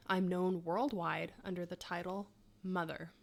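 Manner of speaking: ordinary delivery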